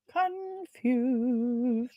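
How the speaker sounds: stretched-out, puzzled single word; drawn-out confusion. Real, not acted. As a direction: draw the word out slowly in confusion. Reacts to a muddled viewer comment.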